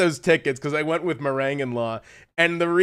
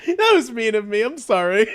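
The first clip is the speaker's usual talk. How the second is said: higher voice through real giggles; helpless, high giggly sorry; laughing apology; 8 semitones higher than usual. Real, not acted. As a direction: apologize while trying not to laugh. Apologizing while cracking up at own joke.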